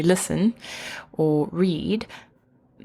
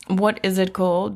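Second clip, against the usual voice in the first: ordinary talk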